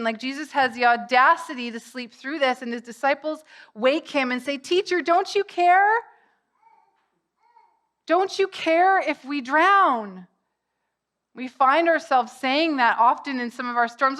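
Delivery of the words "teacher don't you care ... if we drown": high, loud cry of alarm; distressed plea. Acted. Disciples waking Jesus in the storm, distressed plea. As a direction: plead loudly, as if about to drown.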